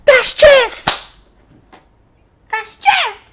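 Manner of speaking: very high, loud, stretched wail; horrified shriek. Real, not acted. Gamer realising he accepted the deal by mistake, horrified shriek. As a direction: shriek in panicked disbelief.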